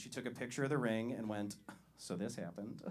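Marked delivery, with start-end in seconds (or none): 2.0-2.8 lightly voiced coy announcement, softer; a hint of a gushing bride-to-be; mock engagement post. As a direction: tease the engagement-post voice with a soft, coy read.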